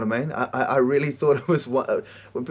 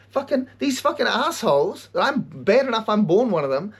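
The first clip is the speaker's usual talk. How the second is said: mock outrage; 7 semitones higher than usual. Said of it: "So I'm like".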